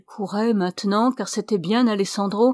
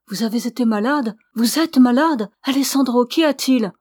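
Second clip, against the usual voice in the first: urgent questions, building higher and louder; alarmed cry; 3 semitones higher, 6 dB louder than usual. A narrator's reading. Woman questioning her haggard beloved, alarmed cry. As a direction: build from concern to a raised, anxious cry. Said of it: "cria Ramona".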